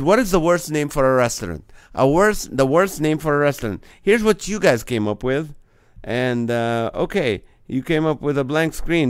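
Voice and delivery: ordinary talk, nothing marked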